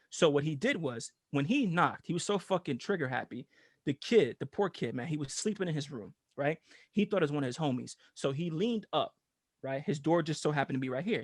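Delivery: ordinary delivery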